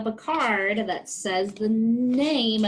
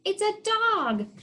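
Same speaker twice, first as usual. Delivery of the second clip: bright reveal; 11 semitones higher, slower than usual. Shown as a demonstration.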